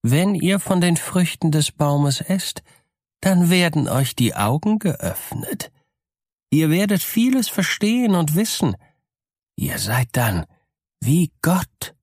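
high, sly tempting serpent voice; serpent dragon. Acted. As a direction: lure her in like a cunning snake. Schlange verführt Eva. (verführerische, listige Schlangenstimme)

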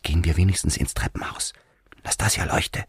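urgent whisper, barely holding back; hushed, voiceless aside. A narrator's reading. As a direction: whisper it with tense self-control. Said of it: "flüsterte ebenfalls".

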